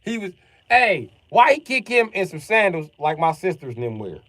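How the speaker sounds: loud, high, amused retelling; worked up laughing at the clip; excited. Real, not acted.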